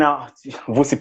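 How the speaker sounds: ordinary talk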